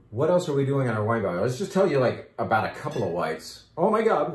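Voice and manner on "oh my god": sudden high, louder outburst; excited exclamation. Real, not acted. Wine host to tasting guests, an excited outburst. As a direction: blurt it out, pitch jumping up.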